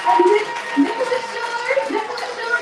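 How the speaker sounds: emcee's excited high-pitched introduction; rallying applause. Real, not acted. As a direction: call out the name for the crowd to cheer. Calls for applause over cheering crowd.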